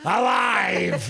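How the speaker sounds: shouted, drawn-out catchphrase; John Fury. Acted. Podcasters trading a fighter's catchphrase, shouted impression. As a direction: bellow it high and loud in impression. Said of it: "a John Fury impression".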